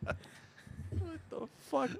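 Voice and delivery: whispered through laughter; laughing disbelief. Real, not acted. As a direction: choke it out laughing, barely voiced.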